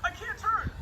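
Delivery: very high-pitched yelp; panicked cry. Real, not acted. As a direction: call out as the controls fail you. Losing control of the plane.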